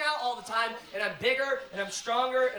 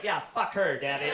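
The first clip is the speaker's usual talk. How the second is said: mock little girl in a low voice; 8 semitones lower than usual. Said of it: "My daughter's like".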